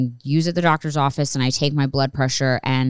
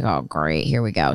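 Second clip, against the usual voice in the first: sarcastic; 10 semitones lower than usual. Retold or imitated. Resigned as ambulance arrives.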